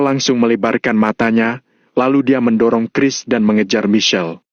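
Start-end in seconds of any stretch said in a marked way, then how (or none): none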